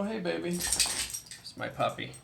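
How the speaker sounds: soft, slow, hushed words to a dog; talking to the puppy. Real, not acted. Owner greeting his puppy mid-story, soft and slow. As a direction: coo gently at the puppy.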